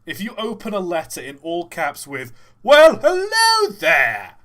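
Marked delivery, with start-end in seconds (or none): 2.6-4.3 much higher and louder, slow shopkeeper greeting; Gregory voice. Shown as a demonstration. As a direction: belt it out as the cheery shopkeeper. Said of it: "should I give Gregory a voice?".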